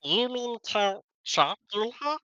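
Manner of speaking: Count Chocula voice. Acted. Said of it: "we're not doing Count Chocula".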